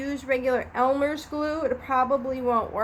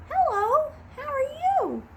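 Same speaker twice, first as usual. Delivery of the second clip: high, squeaky puppet voice far above her own; sock puppet voice; 14 semitones higher than usual. Pretend. Teacher voices the sock puppet greeting children, in a high squeaky voice. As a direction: make the sock puppet chirp hello to the kids.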